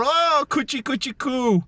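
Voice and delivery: put-on high cooing baby talk. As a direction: coo at the baby, sing-song.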